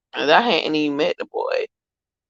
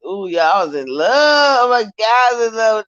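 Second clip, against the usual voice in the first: excited; 7 semitones higher, 3 dB louder than usual. Real, not acted. Gushing over first love.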